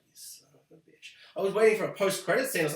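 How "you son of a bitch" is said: breathless whisper from a hospital bed; whispered curse. Retold or imitated. Dexter waking in hospital, cursing in a whisper. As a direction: barely voice it, just air. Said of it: "he's like".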